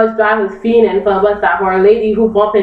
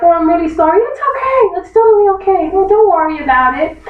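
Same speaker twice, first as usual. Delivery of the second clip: much higher, overly sweet apology; mock polite apology; 8 semitones higher than usual. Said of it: "they're like".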